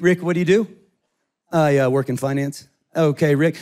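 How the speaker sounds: deep, calm lawyer in court; low lawyer voice. Pretend. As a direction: question the witness like a lawyer.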